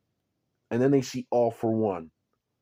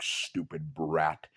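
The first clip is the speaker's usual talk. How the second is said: contemptuous growl; 7 semitones lower, 7 dB quieter, slower than usual. Acted. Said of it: "All For One's like".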